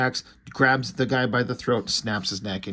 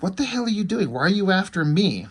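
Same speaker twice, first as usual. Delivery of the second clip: mock girl's voice, much higher and upset; indignant girl; 9 semitones higher, 4 dB louder than usual. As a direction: play the startled girl, pitched way up. Girl confronts Garrison, retold.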